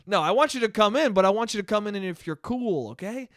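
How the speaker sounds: ordinary talk, nothing marked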